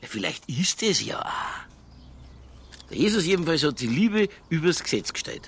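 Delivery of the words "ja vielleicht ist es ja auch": slow, soft whispered concession. Acted. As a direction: breathe it under your breath. Detective concedes under his breath. (leises Flüstern)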